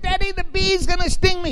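put-on a scared small boy crying out to daddy; frightened little boy. Frightened child calls to dad.

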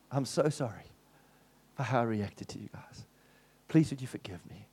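low hushed apology; reading the husband's apology message, hushed; soft whisper. Retold or imitated. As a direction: read it gently, almost whispered.

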